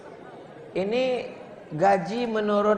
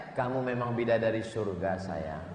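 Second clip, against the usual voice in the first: lower, much softer tender coaxing; sweet-talking husband; 8 semitones lower, 6 dB quieter than usual. Acted. Husband sweet-talking wife, soft and low. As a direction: coo it to soothe her.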